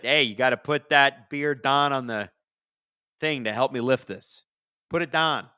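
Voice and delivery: playful Pittsburgh Yinzer impression; demonstrating a working Pittsburgher's Yinzer accent. Shown as a demonstration. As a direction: lean into Pennsylvania vowels, "down" as "dahn". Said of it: "in the Pennsylvania accent".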